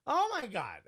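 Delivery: shocked Oh my God. Retold or imitated. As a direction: react as if floored by the story. Shocked reaction in retold scene.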